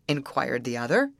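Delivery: ordinary delivery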